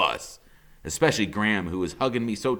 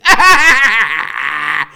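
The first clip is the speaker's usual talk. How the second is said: high, loud manic cackle; maniac laugh; 8 semitones higher, 11 dB louder than usual. Acted. Freak laughing like a maniac after the escape, loud high cackle. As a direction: burst into wild triumphant laughter. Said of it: "Freak is laughing like maniac".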